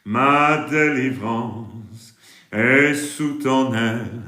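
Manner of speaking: normal talking voice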